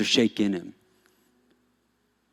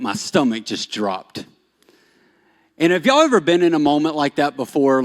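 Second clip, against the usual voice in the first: usual speaking voice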